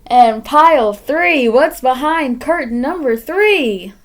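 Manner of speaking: loud, slow showman reveal; game show host. Pretend.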